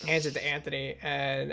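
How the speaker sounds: normal talking voice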